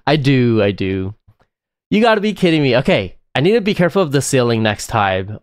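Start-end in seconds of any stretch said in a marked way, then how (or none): none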